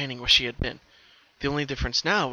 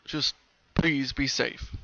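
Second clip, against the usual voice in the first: gentle plea; much slower than usual. A narrator's reading. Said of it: "shook his head slowly".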